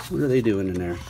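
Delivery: plain reading voice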